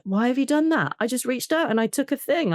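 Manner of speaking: high, whiny imitation of a frustrated toddler; toddler tantrum. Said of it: "the big old toddler tantrum".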